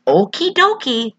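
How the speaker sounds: high, put-on yelps into a slow okie dokie; mock dying gasps in a cartoon voice; silly dying voice. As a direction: ham up a goofy death. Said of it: "it was like".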